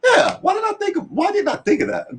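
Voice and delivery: very high, silly put-on voice; mock dumb self. Retelling his own Facebook blunder, very high goofy voice. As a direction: play yourself as a clueless fool. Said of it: "and I was like".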